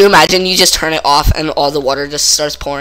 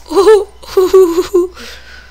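high wailing laugh; 4 dB louder than usual. Real, not acted. After dying in game.

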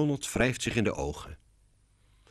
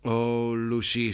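drawn-out, exasperated sigh of a name; mildly despairing, raised-pitch lament; 4 semitones higher, slower than usual. A narrator's reading. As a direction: sigh over your sister. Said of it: "zucht Lionel".

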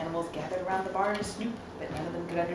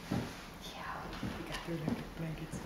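whispered aside; 8 dB quieter, slower than usual. Real, not acted. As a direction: lean in and whisper it. Aside to the child.

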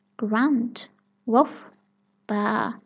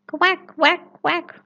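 high, loud quacking duck; duck quacks; 8 semitones higher, 5 dB louder, slower than usual. Acted. Ducks arriving at the picnic, quacking high and loud. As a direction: quack like ducks begging for bread.

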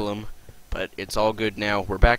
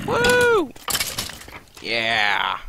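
cheering; 5 dB louder than usual. Real, not acted. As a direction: cheer like you just won. Celebrating a win in the game.

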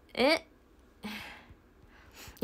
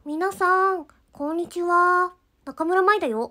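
much higher, bright cartoon girl voice with a slow drawn-out greeting; high-pitched anime idol greeting; cute anime voice; 8 semitones higher, 5 dB louder, slower than usual. Shown as a demonstration. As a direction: greet the fans like an anime heroine. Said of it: "ちょっとチューニングしますね".

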